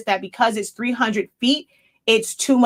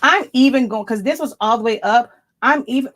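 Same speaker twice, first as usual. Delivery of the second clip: ordinary talk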